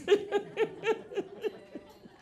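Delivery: high, breathy burst of laughter; embarrassed laugh. Real, not acted. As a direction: laugh at your own mix-up. Laughs at his own blunder.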